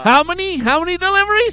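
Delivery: high, loud demanding; mock boss voice. Mocking work phone call.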